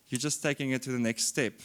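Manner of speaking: ordinary delivery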